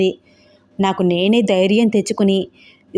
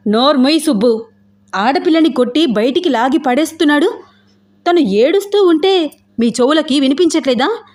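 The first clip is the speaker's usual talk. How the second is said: high-pitched angry rebuke; 6 semitones higher than usual. Acted. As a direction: scold a friend, pitch climbing in indignation. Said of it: "అని కోపంగా అంటూ". (కోపంగా గద్దిస్తూ)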